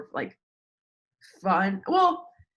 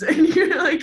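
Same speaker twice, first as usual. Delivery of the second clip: laughing; 11 semitones higher, 9 dB louder than usual. Real, not acted. Laughing about bros.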